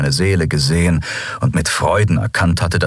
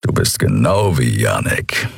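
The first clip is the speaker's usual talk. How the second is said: big furry mechanic teasing his captain; rumbling Keru; 7 semitones lower than usual. A narrator's reading. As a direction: lower creature rumble. Keru speaks to Endriel.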